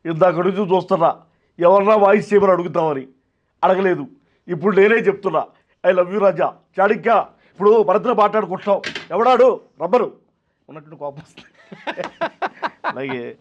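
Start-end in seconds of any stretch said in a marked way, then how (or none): 0.1-10.1 loud, emphatic film-actor impression; Posani. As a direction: punch out each phrase loudly, mimicking the actor. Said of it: "Posani గారు". (పోసాని గొంతు అనుకరణ, గట్టిగా)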